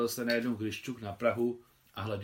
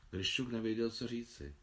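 ordinary delivery

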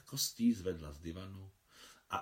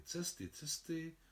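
musing; 2 semitones higher, 6 dB quieter than usual. A narrator's reading. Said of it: "přemýšlela Marie".

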